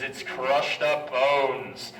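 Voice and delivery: slow, drawn-out punchline; slow, spooky tour-guide reveal; dramatic reveal. Acted. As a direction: let it land slowly for effect. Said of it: "what I like to say most".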